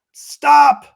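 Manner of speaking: sudden loud, high yell; yelled warning. Retold or imitated. A subcontractor yells at a man running through rain, shouted warning. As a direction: yell it out to halt someone in their tracks. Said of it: "someone yells out".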